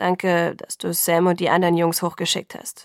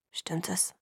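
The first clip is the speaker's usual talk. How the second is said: hushed unvoiced question, much softer; whispered; 2 semitones lower, 9 dB quieter than usual. A narrator's reading. Father whispers to the doctor beside sleeping daughter. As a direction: whisper it so she won't wake. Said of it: "flüsterte Charlie".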